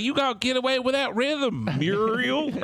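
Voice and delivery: put-on high, twangy old-timer; old cowboy voice. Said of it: "He's like".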